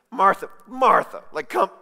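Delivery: loud, high, exasperated "Martha, Martha"; impatient scolding. Retold or imitated. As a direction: snap her name like an annoyed teacher. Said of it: "impatient language".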